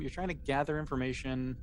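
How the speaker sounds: usual speaking voice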